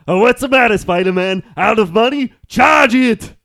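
loud, high, hammed-up Austrian action-star voice; Arnold Schwarzenegger. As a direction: bellow the cheesy villain pun in an Austrian accent. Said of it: "if Arnold Schwarzenegger played Electro".

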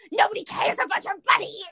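put-on high, snapping bratty girl; mean sister snapping. Retelling the bratty daughter yelling at her brother, snapping high. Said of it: "And the girl goes".

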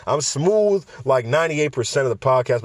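normal talking voice

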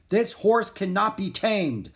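high, loud and drawn-out declaration; angry shout. A narrator's reading. King shouting at the horse seller, loud and slow. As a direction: shout it slowly as a furious king. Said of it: "the king shouted at the horse seller".

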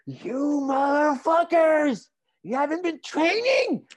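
hurlé très haut et fort, en anglais, lentement; coach furieux qui hurle. Retold or imitated. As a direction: crie ta rage à l'équipe paresseuse. Entraîneur qui engueule l'équipe. (l'entraîneur Charles hurle sur les patineurs, voix stridente et forte)

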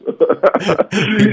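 loud real laugh breaking out; burst of laughter. Real, not acted. Co-host laughs hard at the gossip joke. Joking about gossip sources.

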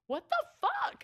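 very high, squealed mock scream; retold scream. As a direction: shriek it without full volume, imitating a freak-out. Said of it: "I screamed".

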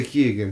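"Keoghan" as KEE-gun, two syllables; own pronunciation. Shown as a demonstration. Telling co-hosts how they say the name, KEE-gun pronunciation. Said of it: "I say Keoghan".

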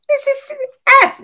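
very high, tight squeak. Retold or imitated. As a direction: squeak the name out in pain. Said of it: "He squeaked out".

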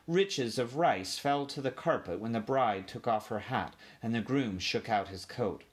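usual speaking voice

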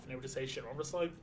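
usual speaking voice

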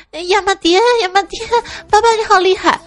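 mock wailing. Ten-year-old howls while dad beats him, mock wailing. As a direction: howl theatrically like a spanked boy. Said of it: "他不断的哭嚎".

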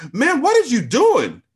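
high, loud, slow outraged yell; angry manager. A narrator's reading. Manager catching her on the floor, outraged yell. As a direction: yell it across the locker room.

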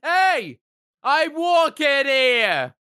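very high, slow-stretched pedestrian yell; hammed-up street holler; mock indignant shout. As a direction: bellow it in comic outrage, stretching the words. Acted yell at people giving way.

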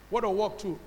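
ordinary talk, nothing marked

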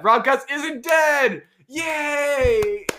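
slow, high, loud cheer; excited. Acted. Cohost announcing the podcast returns, mock excited cheer. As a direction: announce it like big happy news.

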